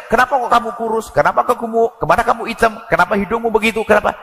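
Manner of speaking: a mildly voiced taunting husband; mocking spouse. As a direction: needle the partner with jabs. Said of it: "Ada macam".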